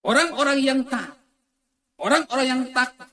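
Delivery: high, forceful preaching shout; loud proclamation. Real, not acted. Preacher describes the obedient and God-fearing, shouting high. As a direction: proclaim it loudly to the congregation.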